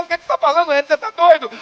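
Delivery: loud, high-pitched disbelief; dramatized astonished questioning; incredulous outburst. Retold or imitated. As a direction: challenge him as an acted bewildered mother. Mother's incredulous reply in story.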